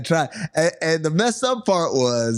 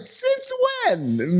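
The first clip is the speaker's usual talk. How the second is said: very high, sceptical "since when"; loud disbelief. Retold or imitated. Friend doubting he likes dogs, very high pitched. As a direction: squeak it out, not buying it. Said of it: "Mad loud".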